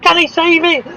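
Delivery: high, rising alarm as the car spins; sudden commentator alarm; excited yell. Real, not acted. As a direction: call the crash with real alarm. Sim race crash commentary.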